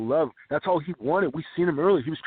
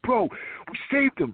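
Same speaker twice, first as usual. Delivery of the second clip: retold excited yell; 10 semitones higher than usual. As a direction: imitate an elated shout in a raised pitch. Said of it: "like, yelling".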